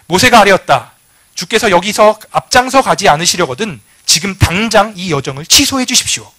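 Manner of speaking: loud, emphatic reading of Moses' prayer; forceful scripture reading. Acted. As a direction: read it out boldly, like a bold plea. (힘차게 성경 낭독)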